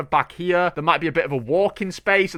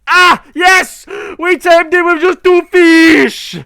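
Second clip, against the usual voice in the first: much higher, louder, slow victory shout; triumphant cheer; 13 semitones higher, 12 dB louder, slower than usual. Real, not acted. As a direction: celebrate taming the cat. Tames cat, cheering.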